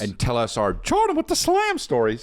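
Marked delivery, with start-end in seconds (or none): 1.2-1.8 very high catchphrase squeal; mock exclamation. Telling listeners about the story segment, high catchphrase squeal. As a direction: jump way up for the catchphrase.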